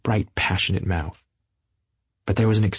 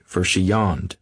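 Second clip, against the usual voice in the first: plain reading voice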